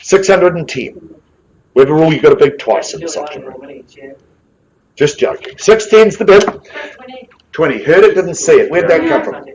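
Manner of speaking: rally the room for opening bids; auction calling. Real, not acted.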